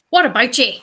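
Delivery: loud Northern Irish greeting; punchy Northern Irish demo; Northern Irish accent. Shown as a demonstration. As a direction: call it out as a Northern Irish hello. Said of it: "the good old Northern Irish hello".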